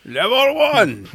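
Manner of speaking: very high, very slow announcer shout; fake Japanese announcer. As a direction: stretch it out like a level-start announcer. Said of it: "their fake Japanese announcer voice".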